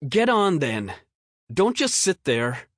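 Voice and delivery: put-on high, loud brusque kid voice; gruff boy. A narrator's reading. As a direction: order him on like a gruff boy. Bo gives in gruffly.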